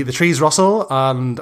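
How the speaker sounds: normal talking voice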